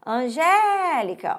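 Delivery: ANGÉLICA called out with a long drawn-out GÉ; calling out. Shown as a demonstration. Teacher calls the word at the gate, Angélica with a drawn-out GÉ. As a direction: stretch the GÉ in Angélica, as if calling someone. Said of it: "vou chamar no portão".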